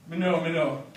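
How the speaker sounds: lightly voiced Jamaican patois. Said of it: "read some patois so you can hear".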